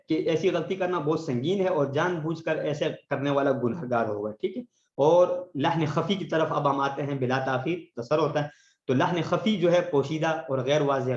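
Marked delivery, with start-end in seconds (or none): none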